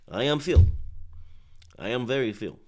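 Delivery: mock Ukrainian accent catchphrase. Shown as a demonstration. Streamer demonstrating the accent to viewers, put-on Ukrainian accent. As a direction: imitate a Ukrainian boxer's accent. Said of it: "The accent".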